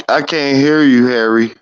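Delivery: ordinary delivery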